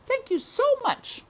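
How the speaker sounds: very high, sweet voice; princess thanking. A narrator's reading. Princess thanking Mig, high and sweet. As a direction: thank her warmly.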